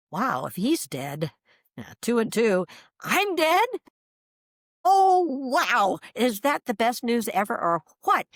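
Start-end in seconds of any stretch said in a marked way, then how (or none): 3.0-3.7 high-pitched, startled question; astonished realization. Retold or imitated. Acting out realizing she is dead. 4.8-8.3 high, loud burst of wonder; thrilled, reenacting the happy discovery; delighted amazement. Delighted reaction to being dead.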